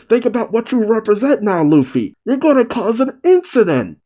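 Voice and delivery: high-pitched acted warning; Jinbei. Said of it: "While Jinbei carrying Atlas says".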